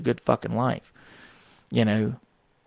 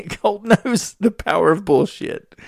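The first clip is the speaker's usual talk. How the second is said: amused punchline; 9 semitones higher, 6 dB louder, slower than usual. Real, not acted. Laughing punchline about son.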